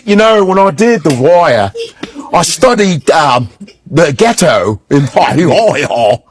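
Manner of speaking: imitating an actor's British accent. Imitating Idris on BBC about The Wire, British accent. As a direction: put on a British interview accent. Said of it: "then I'm watching B B C".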